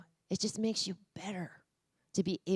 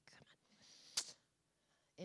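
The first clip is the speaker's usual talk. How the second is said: ordinary talk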